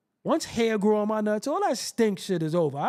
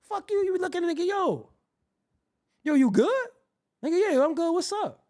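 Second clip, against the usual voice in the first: acted greeting; 10 semitones higher than usual. Acting both sides of greeting.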